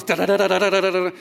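loud rhythmic drum noises; drum-beat vocalizing. Retold or imitated. Angsty teen drumming to vent, loud beats. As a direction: bang out drums with the voice. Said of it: "play my drums".

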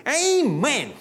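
high, drawn-out Amen from the pew; old lady's Amen. Retold or imitated. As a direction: call out Amen like the old church lady. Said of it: "she'd go".

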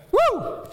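excited preacher whoop; joyful shout; high whoop. Real, not acted. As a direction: let out a high woo. Preacher's exclamation.

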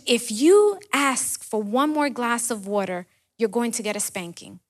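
ordinary talk

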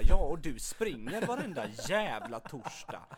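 cheeky imagined comeback; sassy comeback. Retold or imitated. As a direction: hit back with mock attitude. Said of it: "Jag bara".

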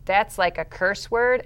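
normal talking voice

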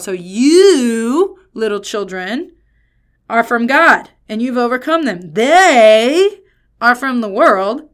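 "you" and "They" blasted long and loud; heavy emphasis on pronouns. Shown as a demonstration. Preacher contrasts believers with the world, heavy stress on you and They. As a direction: hurl out "you" and "They", easing off between. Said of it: "the emphasis that I think".

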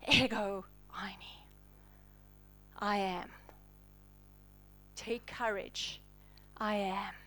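calm frightened men in a near whisper; hushed reassurance. Acted. Jesus to the terrified disciples, soft reassuring whisper.

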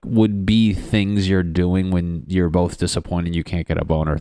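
normal talking voice

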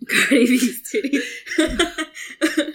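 slow, laughing repeat. Real, not acted. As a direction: drag it out while laughing.

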